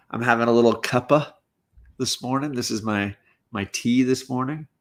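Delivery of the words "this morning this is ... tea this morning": ordinary delivery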